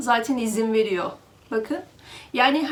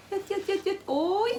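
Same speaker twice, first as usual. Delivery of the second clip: coaxing cat call; 8 semitones higher, 4 dB quieter than usual. Real, not acted.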